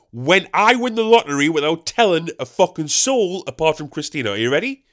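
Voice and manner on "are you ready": usual speaking voice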